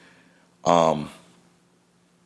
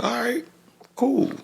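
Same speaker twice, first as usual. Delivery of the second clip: skeptical all right. Retold or imitated. Son answers his friend's music plans in a high, doubtful voice. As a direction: humour him with a high, doubtful cool. Sarcastic reply to son's music plan.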